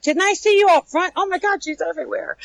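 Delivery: high-pitched imitation of baffled guests; mock amazement. Guests spotting the costumed trainer everywhere, mock amazed. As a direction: imitate a guest's startled double take, voice up high.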